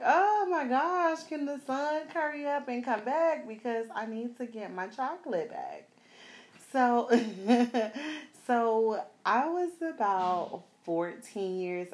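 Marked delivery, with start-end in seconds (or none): 0.0-5.9 high, slowed longing cry to the sun; hammed-up wish for sunshine; mock wishful plea. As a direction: plead with the sun playfully. Wishing aloud for her tan.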